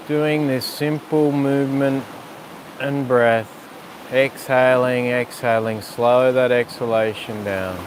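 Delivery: calm yoga teacher guiding a breath sequence; guided breathing cues. Acted. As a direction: talk them through each breath gently. Leads breathing practice.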